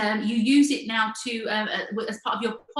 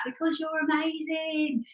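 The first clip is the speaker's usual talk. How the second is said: slow, high cheer from the crowd; shouting out; 7 semitones higher, slower than usual. Retold or imitated. Audience member cheering on the winner, slow high shout. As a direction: call it out slowly to the stage. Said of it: "I just remember shouting out".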